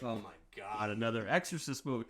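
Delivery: low, soft, weary mock groan; mock exasperation. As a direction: imitate a fed-up moviegoer under the breath. Acting out weary audience reaction to studio.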